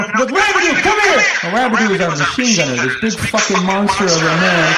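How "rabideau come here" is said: high, yelled summons; sergeant calling out. Acted. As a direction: yell a soldier over to you. Sergeant calls a soldier over.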